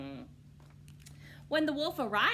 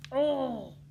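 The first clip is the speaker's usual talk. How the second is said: a louder, higher fake gasp; mock gasp; 6 semitones higher, 9 dB louder than usual. Wolf luring Little Red to flowers, mock gasp. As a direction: pretend to be amazed. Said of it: "he gasped".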